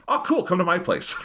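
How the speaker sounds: high-pitched, breezily unfazed mock reply; mock-casual invitation. Podcaster jokingly voicing Shiki welcoming a confessed murderer, mock-casual. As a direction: toss it off as a joking imitation.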